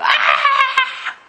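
shrill high scream, loud; child's scream. Retold or imitated. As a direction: shriek like a scared child. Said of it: "screamed in the toilet".

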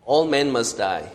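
ordinary talk, nothing marked

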